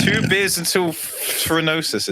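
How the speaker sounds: high, slow, hammed-up show title; podcast host intro. Guest trying the podcast's name after teasing, hammed-up announcer intro. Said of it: "expecting you to come in like".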